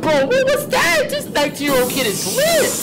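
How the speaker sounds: high, excited exclamation; real astonished hype; amazed outburst. Real, not acted. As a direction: blurt it out high, blown away. Amazed reaction to animation.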